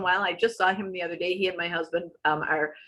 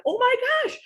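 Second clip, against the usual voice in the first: squeaky high "oh my gosh" of realization; shocked gasp; 4 dB louder than usual. Retold or imitated. Telling the audience her realization, in a high, stunned voice. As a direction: jump way up in pitch, stunned. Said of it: "I'm like".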